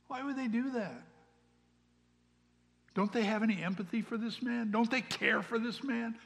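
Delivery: high-pitched incredulous questions; indignant questioning. Acted. Acted indignation on the beggar's behalf.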